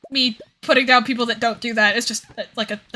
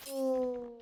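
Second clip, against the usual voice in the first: normal talking voice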